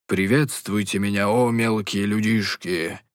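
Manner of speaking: slow, hollow ghost-story voice; spooky, drawn out like a ghost; sepulchral voice. Acted. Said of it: "загробным голосом произнёс Кейси".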